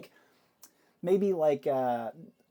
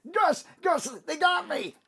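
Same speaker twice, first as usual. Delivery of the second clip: very high, acted scream for help; high-pitched wail from a hit goon; panicked scream; 14 semitones higher, 4 dB louder than usual. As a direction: scream for your boss as a wounded henchman. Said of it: "you hear him scream".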